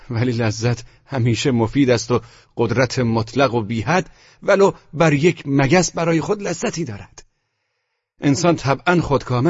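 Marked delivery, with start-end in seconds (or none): none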